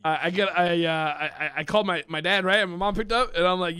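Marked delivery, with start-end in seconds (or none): none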